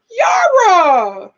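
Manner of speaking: loud, high call falling away; mock scolding shout. Host calling out Yara over the trailer remark, mock scolding shout. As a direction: holler the name in playful disbelief.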